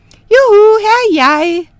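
put-on high, loud teasing call of a hiding girl. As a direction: yell it out to taunt the moon man. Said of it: "og råbte".

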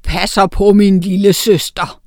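loud, slow troll voice; a big troll brother speaking out. A narrator's reading. Troll and witch confront.

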